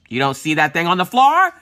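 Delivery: sudden loud burst at the end; yelling at kids. Acted. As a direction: build from a question into a scary parent's yell. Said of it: "I will yell at them".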